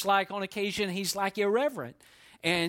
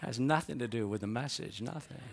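quiet, dry deadpan, lower and softer; deadpan aside; 6 semitones lower, 6 dB quieter than usual. Real, not acted. Pastor repeats his joke to the congregation, quiet and deadpan. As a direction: throw the line away under your breath. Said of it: "repeats the opening joke".

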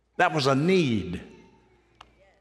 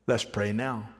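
ordinary talk

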